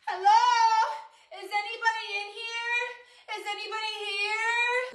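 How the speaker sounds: high, soft, trembling call into the dark; scared horror movie victim. Pretend. Movie resident calling out to a killer in the house, frightened and hushed. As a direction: call out timidly like you dread an answer. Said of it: "the resident is just like".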